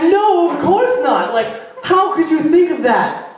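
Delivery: high, indignant denial; mock wounded innocence, high; high defensive. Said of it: "I was like".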